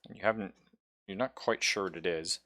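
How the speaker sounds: normal talking voice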